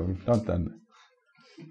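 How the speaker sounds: very soft, slow, dry aside; ironic hush. Real, not acted. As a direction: draw it out quietly with irony.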